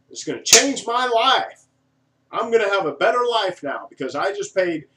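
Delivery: high, loud overexcited gushing; mock starry-eyed customer voice; excited buyer. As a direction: gush like a buyer sold on a dream.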